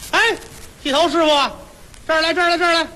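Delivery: high calling voice hailing a passing barber. Acted. Wife at her door hailing a passing barber, high calling voice. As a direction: call him over from the doorway, voice lifted high.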